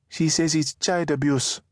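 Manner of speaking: the son reporting his sister's excuse; boy's reply. Acted. Boy reports Maisha's words.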